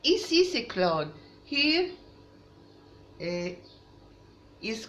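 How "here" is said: ordinary talk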